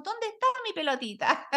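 high, bewildered little question; mock-lost, asking where it went; playful high-pitched puzzlement; puzzled searching. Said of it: "y después decimos".

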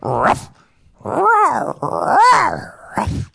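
high, growly animal barks; dinosaur voice. Pretend. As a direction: bark like an angry beast. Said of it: "I'm angry tonight".

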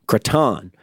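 "Cretan" as kruh-TAHN; end-stressed pronunciation. Shown as a demonstration. Host playing with the director's surname, "Cretan" as kruh-TAHN. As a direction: stress the last syllable of "Cretan", with an open ah. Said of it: "I think it's Cretan".

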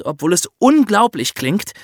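usual speaking voice

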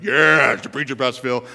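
loud mock cheer dropping off in level; imitated cheering. Pastor imagining the men backing his sermon, imitated loud cheering. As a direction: whoop like an approving congregation. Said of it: "the men were like".

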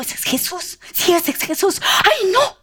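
high, urgent voice of a blind beggar; excited discovery. Acted. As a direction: start hushed and high, rising to a yelp. Blind man realises Jesus is passing. (emocionado, voz aguda y agitada)

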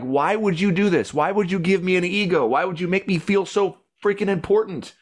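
high, loud desperate questions building to a shout; hurling every why at God until she breaks into a yell. Retold or imitated. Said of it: "crying and I'm, like, yelling at God".

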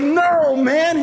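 dad protesting, voice up; high protest. Retold or imitated. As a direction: refuse it in disbelief. Dad refusing.

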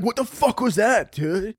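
high-pitched mock alarm; retold startled reaction. Sleeper hearing monkey noises in bed, startled imitation. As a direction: act jolted awake, voice jumping up. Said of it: "I'm like".

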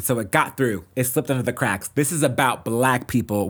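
normal talking voice

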